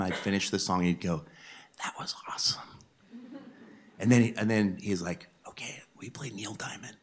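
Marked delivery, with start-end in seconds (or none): 1.8-2.6 faint, whispered praise; imitating weak friend. Sick man praising his friend's song, whispered faintly. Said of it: "he could barely talk at this point". 5.5-7.0 barely audible whispered request; imitating weak friend. Sick man requesting Neil Diamond from his friend, whispered. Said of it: "he could barely talk at this point".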